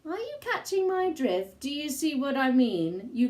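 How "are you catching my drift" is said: ordinary delivery, nothing marked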